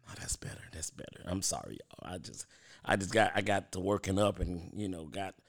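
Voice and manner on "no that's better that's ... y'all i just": very soft, low, almost whispered; hushed, winded apology; spent and relieved. Real, not acted. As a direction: say it under your breath, recovering. Winded, recovering after dancing.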